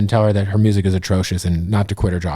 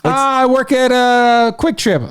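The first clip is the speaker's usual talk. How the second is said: much higher, meek reply; high dopey voice; 11 semitones higher, slower than usual. Retold or imitated. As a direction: answer like a clueless kid. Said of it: "Well, what do you do, buddy?".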